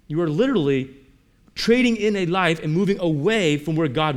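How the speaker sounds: loud, impassioned preacher's delivery; fervent preaching. Acted. Preacher urging the congregation to stay put with God, loud and fervent. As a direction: pound it home from the pulpit.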